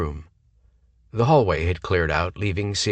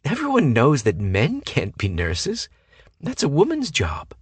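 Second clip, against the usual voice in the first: 1 ditzy voice; 8 semitones higher than usual. A narrator's reading. Ditzy woman lectures.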